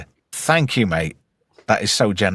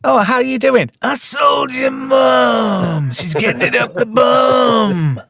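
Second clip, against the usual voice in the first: high, slow, shouted alien shopkeeper voice; Watto voice; 12 semitones higher, slower than usual. Retold or imitated. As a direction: drawl it out like a greedy alien trader. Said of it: "Apparently, I sound like".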